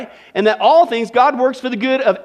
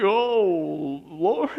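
soft, lower, deflated Oh, Lord; weary sigh; 3 semitones lower, 4 dB quieter than usual. Shown as a demonstration. Preacher to congregation, the other way: a sighing Oh, Lord. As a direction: sigh it out like giving up. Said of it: "You could say that like that too".

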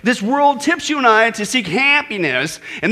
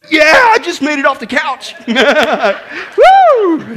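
louder, higher hammed-up cheer with a laugh; mock triumph; 5 semitones higher, 7 dB louder, much slower than usual. Preacher mocking old-age weightlifting with a hammed-up cheer. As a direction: celebrate getting off the couch like a big win.

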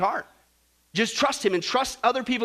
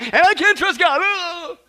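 high, loud mock protest; exasperated mockery; 7 semitones higher, 9 dB louder, slower than usual. Preacher mocks doubters who trust strangers, exasperated. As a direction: explode in exasperation with a groan. Climax mocking the doubters' excuse.

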